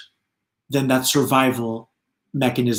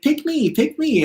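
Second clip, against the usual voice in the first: very high, squeaky eager little voices; lightly cartoonish chorus of pick me; eager spirits; 12 semitones higher than usual. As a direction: play the spirits begging to be chosen. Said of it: "many energies who are like".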